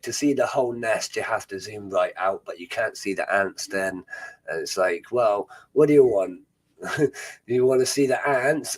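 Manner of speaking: ordinary delivery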